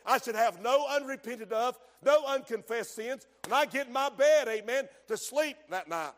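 normal talking voice

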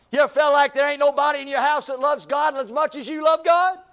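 high, loud, fast fervent preaching; building sermon cry. Acted. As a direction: push it hard at the congregation. Preaching at full force.

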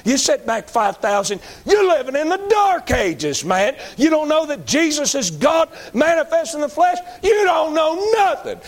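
fiery revival preacher rising in pitch; preaching at full force. Acted. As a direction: preach it up to a shout. Preacher building to a shout.

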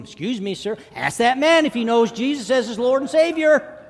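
put-on high, loud boy's shout, building. Boy has Stan ask the man about Jesus, shouting. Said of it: "start getting louder and louder".

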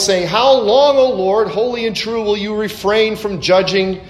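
high, loud pleading cry; loud lament, pitched high. Acted. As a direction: cry out to the Lord in anguish. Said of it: "they cried out with a loud voice".

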